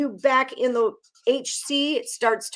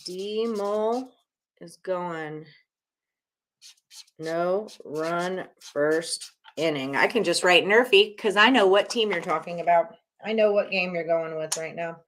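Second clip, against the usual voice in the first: ordinary delivery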